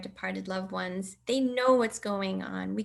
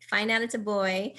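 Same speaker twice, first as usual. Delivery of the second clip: ordinary delivery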